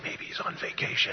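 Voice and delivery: voiceless divine whisper; hushed, sly feeding of a line; whispered prompt. Acted. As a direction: whisper the joke as a secret cue. God whispering lines to Elijah.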